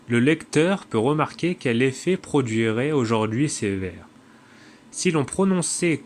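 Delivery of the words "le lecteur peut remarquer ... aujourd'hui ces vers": ordinary delivery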